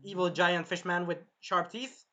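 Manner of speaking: normal talking voice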